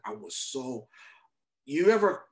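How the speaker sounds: usual speaking voice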